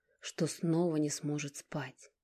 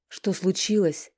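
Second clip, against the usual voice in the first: anxious and slow, louder; nervous; 4 semitones higher, 8 dB louder than usual. Acted. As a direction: ask in rising alarm. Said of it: "занервничала Ника".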